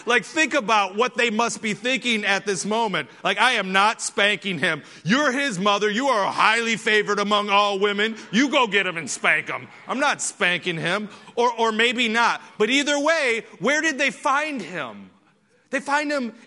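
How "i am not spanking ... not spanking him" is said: joking indignant father refusing; playful husband arguing with his wife; mock parent quarrel. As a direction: loudly push the job onto the wife. Parents arguing who spanks Jesus.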